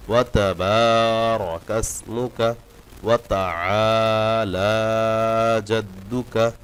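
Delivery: ওয়া তাআলা with the আইন from the throat and drawn out; slow, loud, stretched ওয়া তাআলা; tajweed demo. Shown as a demonstration. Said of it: "আইন অবশ্যই গলায় নেবেন". (আইন গলা থেকে টেনে)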